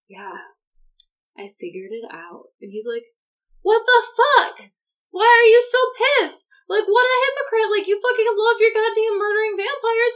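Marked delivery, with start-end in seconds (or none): 0.1-2.4 low, soft, reluctant answer; subdued sullen reply. Retold or imitated. Bella's sullen reply acted out. 3.6-10.1 high, loud, indignant retelling; mock angry outburst. Acting out Jacob's angry outburst.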